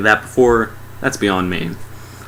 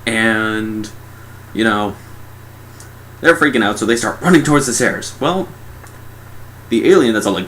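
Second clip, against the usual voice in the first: normal talking voice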